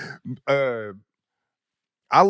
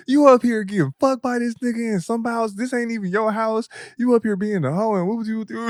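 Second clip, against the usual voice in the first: higher-pitched mock scolding from the mom; 7 semitones higher than usual. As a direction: tell the girl off, lightly voiced. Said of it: "she was like".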